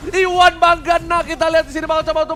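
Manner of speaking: loud hyped play-by-play; fighting game announcer. Real, not acted. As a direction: call the teamfight at full hype. Hype at triple kill chance.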